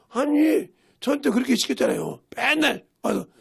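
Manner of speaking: mock child retort. Child retorts to teasing uncle.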